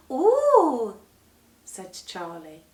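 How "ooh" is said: put-on high, loud child's marvel; amazed ooh. A narrator's reading. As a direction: marvel at the cherries. Charlie marvels.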